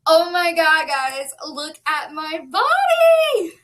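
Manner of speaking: gushing over her own body, drawn out; real excitement. Real, not acted. Vlogger showing viewers her weight loss, thrilled and loud.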